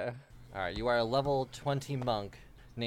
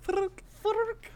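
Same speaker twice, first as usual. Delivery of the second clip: high squeaky creature chant; 3 dB louder, much slower than usual. Acted. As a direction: squeak the name like a little critter. GM voices Fork character.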